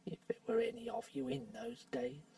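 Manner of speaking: unvoiced, hushed aside; a dwarf's sly muttered aside; whisper aside.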